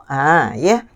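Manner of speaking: ordinary talk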